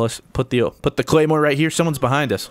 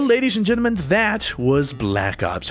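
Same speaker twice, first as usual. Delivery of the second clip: announcer sign-off; 5 semitones higher than usual. Acted. Closing the gameplay like a host.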